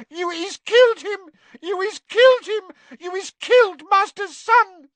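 loud, very high elf wailing; piercing shriek. A narrator's reading. Winky believes master's son is killed, piercing shriek. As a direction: wail the accusation in a piercing elf voice. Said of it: "let out a piercing shriek".

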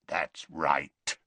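low, rasping beast of a man. A narrator's reading. Greyback confirming himself to Dumbledore, rasping. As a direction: rasp it low. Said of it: "rasped the other".

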